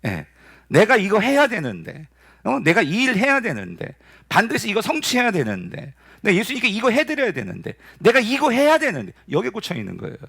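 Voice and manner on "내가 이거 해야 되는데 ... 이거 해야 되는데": fretful inner voice. Acted. Acting out Mary's fixated inner voice.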